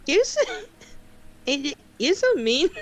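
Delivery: giggly protest. Real, not acted. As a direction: complain while breaking into giggles. Repeated protest amid giggles.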